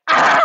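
short happy shriek; excited scream. Retold or imitated. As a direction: squeal of delight.

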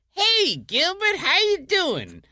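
slow, high friendly hail; lightly voiced Nipsey greeting; mock greeting. Nipsey Russell greets Gilbert at an event, slow and high.

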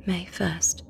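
soft unvoiced whisper of the date; whispered heading. A narrator's reading. Narrator whispering the diary heading.

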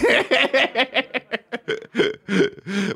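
high, drawn-out chuckling fit; helpless high laughter; real long laugh. Real, not acted. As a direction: crack up at your own joke. Cracks up at own joke.

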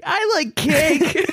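a giggly, drawn-out self-parody; mock naive voice. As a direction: play it slow and innocent through a laugh. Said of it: "coming in like".

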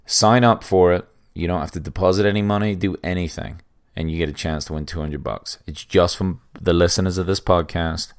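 ad read. Acted. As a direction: sell the app to listeners. Reads a sponsor ad.

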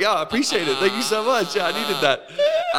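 high-pitched, hammed-up gratitude; mock your own gullible thanks, voice lifted high; gushing thanks. Retold gullible thanks to thief.

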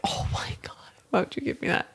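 whispered mock dismay; hushed, playful protest. Real, not acted. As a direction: whisper it in disbelief at the challenge. Dismayed at being given an octopus.

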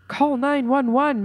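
a friend crying out for help; cried out. Acted. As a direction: call it out in alarm. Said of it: "cried suddenly".

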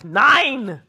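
loud, high barked German "Nine!"; hammed-up German accent shouting "Nine!"; mock German. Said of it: "The Germans are like".

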